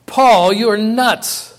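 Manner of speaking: high, loud and slow outburst; a governor shouting down a prisoner; loud exclamation. Acted. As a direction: boom it out at Paul in disbelief. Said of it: "said in a loud voice".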